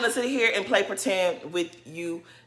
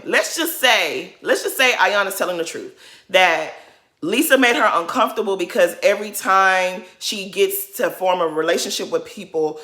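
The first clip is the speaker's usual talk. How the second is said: ordinary talk, nothing marked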